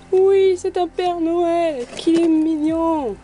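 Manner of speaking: oui lent, aigu et ravi; s'extasier devant un chocolat; attendri. Real, not acted. (ravie, voix lente et aiguë)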